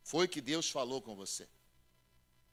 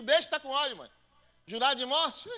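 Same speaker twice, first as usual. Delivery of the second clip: defiant son; 9 semitones higher, 7 dB louder than usual. Retold or imitated. Son retorts to mother.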